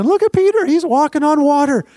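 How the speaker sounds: high-pitched, thrilled cry to the others; excited disciples. Acted. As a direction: call out in amazement, voice soaring. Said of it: "They're all excited".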